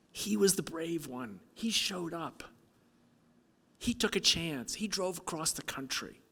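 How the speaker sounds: high, strained voice close to tears; emotional. Real, not acted. Speaker praising the young man's bravery, high emotional voice. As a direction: push through real emotion, pitched high and fading at phrase ends. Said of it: "sorry, I'm getting emotional".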